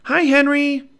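high loud call across the yard; shouted greeting. Acted. Annie shouts to the neighbor's dog from the treehouse. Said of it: "shouted Annie".

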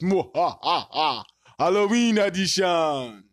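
evil laugh, then spooky host voice; slow theatrical villain menace. Pretend. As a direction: ham up a Halloween villain. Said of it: "it's the Halloween episode. You gotta do stuff".

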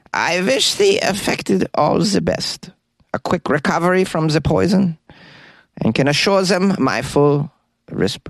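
mad scientist from a German lab. Acted. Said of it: "mad scientist impression".

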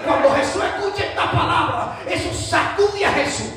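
high-pitched, forceful sermon delivery; impassioned preaching. Real, not acted. Preacher telling church of the woman's answer to Jesus, impassioned preaching. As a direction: preach it out to a responding congregation.

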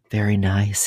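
ordinary delivery, nothing marked

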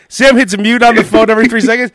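usual speaking voice

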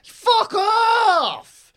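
high, drawn-out shout of derision; exasperated yell. Acted. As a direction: bellow it at the TV pundit, stretching each word high. Ranting back at imagined TV pundit.

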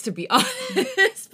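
laughing high and loud. Real, not acted. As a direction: laugh through the words. Laughing through an awkward admission.

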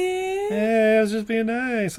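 hamming up a creep's excuse; mock creep. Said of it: "He's like".

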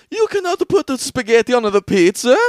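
loud, high mock Italian accent. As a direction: ham up an Italian guy scolding about pizza. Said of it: "I forgot the Italian accent".

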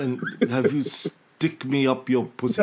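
halting royal English accent, mock-earnest; a hammed Charles-style royal accent, with pauses; Prince Charles accent. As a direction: put on Charles's hesitant upper-class English. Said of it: "doing my Prince Charles accent".

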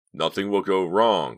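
slow, loud, sharp insistence. A narrator's reading. Leader silencing the driver's fears, sharp. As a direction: shut down the doubt firmly. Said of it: "Clay said sharply".

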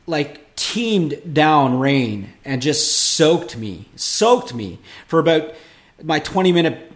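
ordinary talk, nothing marked

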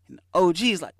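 usual speaking voice

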